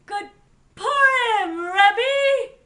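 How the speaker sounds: loud, high, ringing holiday call; joyful greeting shout. A narrator's reading. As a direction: hail the rabbi at full voice. Pinchas returns to the rabbi.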